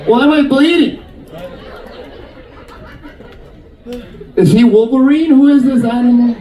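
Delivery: high, indignant disbelief; mock outrage. Woman kicked by a long toenail, mock outrage.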